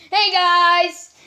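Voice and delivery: loud, brash call; obnoxious greeting. Acted. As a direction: barge in loudly. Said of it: "Amethyst said obnoxiously".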